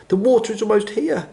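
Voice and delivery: high, urgent squeaky giraffe; high-pitched squeaky animal voice; high-voiced giraffe. A narrator's reading. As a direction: pipe up high with a warning. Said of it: "in his high voice".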